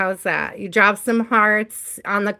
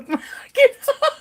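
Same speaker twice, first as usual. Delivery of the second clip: high laugh breaking out mid-sentence; sudden high cackle; real laughing fit. Real, not acted. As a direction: crack up helplessly.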